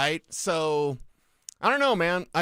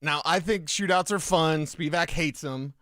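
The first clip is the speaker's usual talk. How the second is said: ordinary delivery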